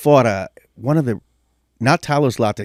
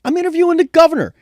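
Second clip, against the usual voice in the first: high and fast, defensive; imitating himself answering the boss; mock protest; faster than usual. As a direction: rush it out, falsely innocent. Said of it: "I'm like".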